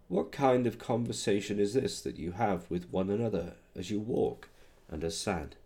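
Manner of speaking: usual speaking voice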